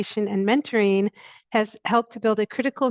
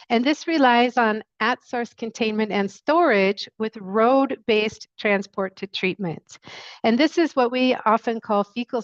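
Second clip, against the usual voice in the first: louder, projecting for the listener; speaking louder; 5 dB louder than usual. Real, not acted. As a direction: raise the volume and project clearly. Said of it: "I'll just talk a bit louder then".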